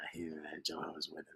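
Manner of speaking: hushed, secretive whisper. Pretend. Speaker contrasting the formal name, in a hushed whisper. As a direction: breathe it like a scandal.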